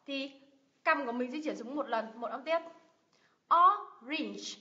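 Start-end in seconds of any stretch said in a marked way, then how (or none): 3.5-4.6 orange split slowly as 'o... range'; louder orange, broken syllable by syllable; two separate syllables. Shown as a demonstration. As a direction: demonstrate the two beats in orange. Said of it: "hai lần, hai âm tiết".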